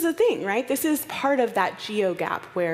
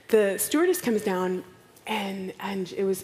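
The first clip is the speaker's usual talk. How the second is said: ordinary talk, nothing marked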